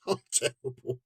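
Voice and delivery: giggling through the words; half laughing, self-mocking. Real, not acted. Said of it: "I can't stop laughing".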